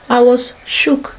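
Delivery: slow, drawn-out I was shook; voiced again and slowed right down; slow emphasis. Shown as a demonstration. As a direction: stretch each word, still stunned.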